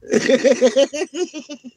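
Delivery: loud, high burst of laughter. Real, not acted. Laughing at own Canada joke, loud and high.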